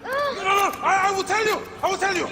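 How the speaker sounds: high, loud, breathless pleading; frantic pleading. Acted. Father pleading with the captors, frantic and loud. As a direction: promise anything to save him.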